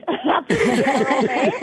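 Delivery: warm laugh through the thanks. Real, not acted. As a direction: laugh while thanking him. Caller laughs at compliment.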